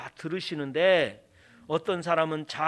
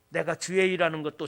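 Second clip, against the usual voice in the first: normal talking voice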